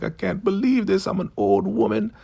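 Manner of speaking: put-on very high, wailing old-woman voice; exaggerated falsetto despair; mock anguish. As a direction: wail it like a terrified old woman, hammed up. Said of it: "She is, like, in anguish".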